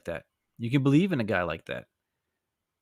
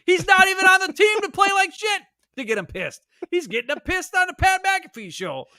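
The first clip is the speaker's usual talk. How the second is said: much higher, shouted disbelief; high-pitched exasperated outburst; incredulous rant. Real, not acted. Ranting about Rodgers angering fans.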